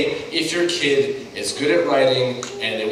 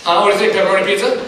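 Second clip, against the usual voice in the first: teasing buddy, building louder and higher; goofy mock suggestion, growing more insistent; Chris; 5 semitones higher, 4 dB louder than usual. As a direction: needle a friend with a joke you find hilarious. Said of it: "my buddy Chris is like".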